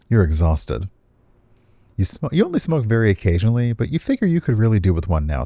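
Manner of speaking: ordinary talk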